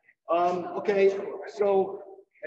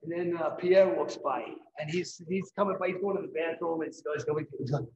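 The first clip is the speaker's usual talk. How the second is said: normal talking voice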